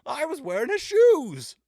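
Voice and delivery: very high, loud, cartoonish drunk robot voice; hammed-up silly Vision impression; comically funny voice. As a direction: squeak it out like a tipsy broken android. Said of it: "his voice is, like, comically funny".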